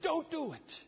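sudden high cry of refusal; forceful command. Retold or imitated. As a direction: act out the angel's instant order, pitch leaping up. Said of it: "instantaneous and with all force".

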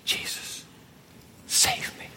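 breathy whispered plea, barely voiced. The dying thief whispers to Jesus, a faint plea. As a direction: whisper it like a dying man begging. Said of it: "before he goes".

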